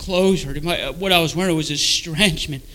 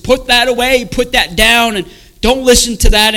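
loud, high, urgent commands; loud cursing voices; 6 semitones higher, 9 dB louder than usual. Retold or imitated. Tormenting voices at a man opening his Bible, loud and commanding. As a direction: order it away, voice raised high. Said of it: "kept getting louder".

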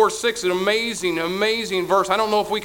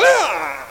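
mock startled gasp; 4 dB louder than usual. Acted shock at whiteness.

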